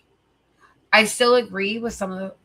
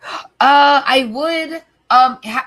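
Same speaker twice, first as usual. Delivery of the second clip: choked up; 6 semitones higher, 10 dB louder, much slower than usual. Real, not acted. Getting emotional saying goodbye.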